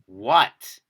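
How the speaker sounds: loud one-word yell down a hallway; retold yell. Storyteller re-enacts yelling down the hallway at the knocks, loud yell. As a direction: yell it out, startled. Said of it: "I literally just yell".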